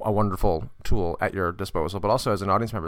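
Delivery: usual speaking voice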